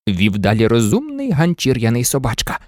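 plain reading voice

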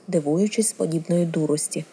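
ordinary delivery, nothing marked

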